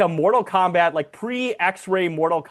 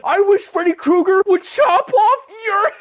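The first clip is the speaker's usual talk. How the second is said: Travolta; 5 dB louder than usual. Retold or imitated. Movie fan mimicking Moose threatening Todd, overwrought Travolta impression. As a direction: try hard to menace, come off juvenile. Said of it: "you sound just like Travolta in this movie".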